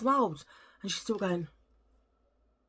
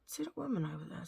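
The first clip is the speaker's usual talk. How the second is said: much softer, lips barely moving; talking without moving lips; 11 dB quieter than usual. Retold or imitated. To her sister, lips still so the starer won't notice. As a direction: mutter it out the side of the mouth. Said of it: "I'm trying to talk like that".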